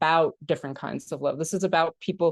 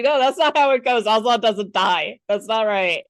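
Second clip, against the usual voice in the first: raised-voice mock protest; retold childhood outrage, playfully insistent; upset child; 2 semitones higher, 4 dB louder than usual. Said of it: "I was so fucking upset".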